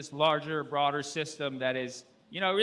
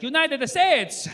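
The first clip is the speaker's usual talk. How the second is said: "United States!" said slowly and high with Spanish vowels; mock Spanish accent; Spanish-style United States; 9 semitones higher, 6 dB louder, slower than usual. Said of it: "he, like, Spanishized it".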